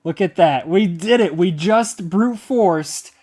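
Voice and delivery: thrilled and emphatic; triumphant. Real, not acted. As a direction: declare the win with real excitement. Celebrates the brute force success.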